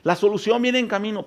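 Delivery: high, loud call of God from the storm; booming divine call, pitched high; God shouting. Acted. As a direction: cry out as God calling his people. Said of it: "Dios nos está gritando".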